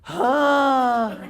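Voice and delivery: loud exhaled huh; teen frustrated huff. Shown as a demonstration. Teacher demonstrating the teenage frustrated huff. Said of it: "but make that".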